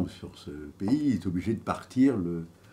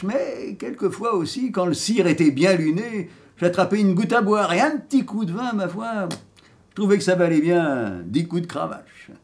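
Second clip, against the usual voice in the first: proud, cheeky rogue, louder and higher than chat; dead vagabond telling his life; 4 semitones higher, 7 dB louder than usual. Acted. Dead man boasting of his life, theatrical and defiant. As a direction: declaim like a storyteller, rising on each boast. Acts the dead man's monologue.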